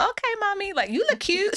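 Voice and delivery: put-on high, sweet child voice; little daughter. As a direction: imitate a small girl complimenting mommy. Voicing her little daughter.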